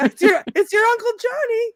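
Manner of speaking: very high, attention-seeking holler; hammed-up calling out. Uncle announcing himself at school, hammed-up high call. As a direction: call it out high, as an embarrassing relative.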